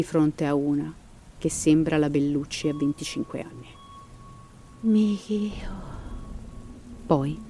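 dramatic storyteller reading a ghost legend; story narration. A narrator's reading. Narrator telling listeners the Llorona legend, dramatic storytelling.

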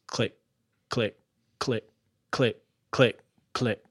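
evenly spaced, separate clicks; short words with pauses between; imitated mechanical clicking. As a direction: mimic a machine ticking closer. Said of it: "this mechanical clicking sound".

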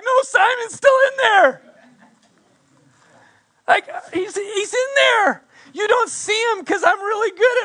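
high laughing insistence, half crying; laughing mock protest. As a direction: laugh through a mock denial. Mocking own denial, laughing.

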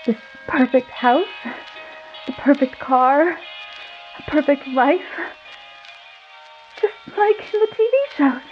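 high, wavering wife voice; trembling voice. Acted. Wife talking to Michael from the kitchen, trembling high voice. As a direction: quiver through it, barely holding together. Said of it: "in a trembling voice".

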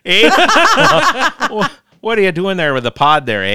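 hammed-up Canadian accent, sliding into Irish; mock Canadian accent. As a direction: joke Canadian accent with a laugh, high on eh. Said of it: "Now you're Irish again".

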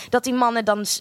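normal talking voice

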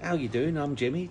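a hint of Glaswegian Cockney, imitating a friendly mate; lightly done Glaswegian Cockney greeting.

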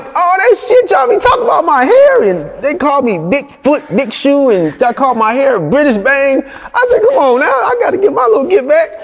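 high, loud, laughing tease; crowing over his revenge; gleeful payback. Retold or imitated. Director laughing as he teases Thug back.

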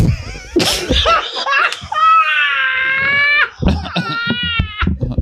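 shrieking, wheezing laugh; hysterical laughter. Real, not acted. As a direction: crack up completely. Huge laugh at the joke.

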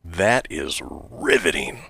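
slow, soft mock fascination; deadpan sarcasm. Co-host reacting to window envelope patent, slow deadpan sarcasm. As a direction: draw it out drily, unimpressed.

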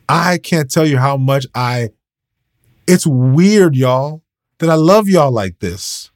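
ordinary talk, nothing marked

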